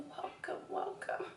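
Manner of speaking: breathy whispered welcome. Acted. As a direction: whisper it seductively. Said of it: "I can't talk like that".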